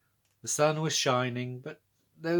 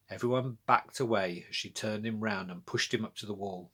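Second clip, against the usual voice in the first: plain reading voice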